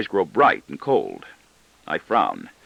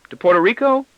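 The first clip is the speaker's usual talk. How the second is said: put-on boy's voice; 13 semitones higher, 5 dB louder than usual. A narrator's reading. Boy voiced, asking.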